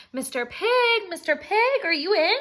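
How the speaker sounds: high, loud call through the door. Acted. Wolf calls to the third pig, high and loud.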